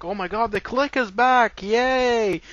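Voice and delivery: high, loud mock fanboy delight; sarcastic mock cheer. Mocking Punk's fake excitement over the Clique's return, loud and high. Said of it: "C M Punk goes off and he's like".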